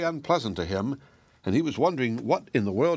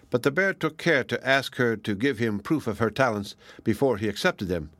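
plain reading voice

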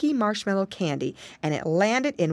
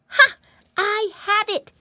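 put-on high, loud piggy-boy eureka; excited cry; 5 dB louder than usual. A narrator's reading. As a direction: burst out with a sudden bright idea. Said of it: "he cried".

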